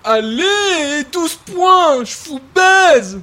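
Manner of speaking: loud, slow, gloating victory cry; triumphant gloat. Acted. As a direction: rub the win in their faces. Triumphant gloat after a scoring shot.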